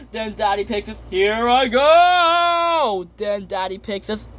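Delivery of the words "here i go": high, long drawn-out "go"; slow, high take-off yell; launching cry. Acted. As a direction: call it out as you leap, stretching the last word. Daddy Pig takes the plunge.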